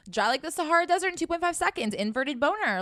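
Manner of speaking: high, fast comic exaggeration; excited. Real, not acted. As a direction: blurt it out, amused.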